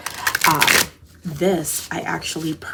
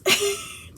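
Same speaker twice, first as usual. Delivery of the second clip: high gleeful giggle; squealing laugh. Real, not acted. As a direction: giggle at your own excitement. After joyful reaction.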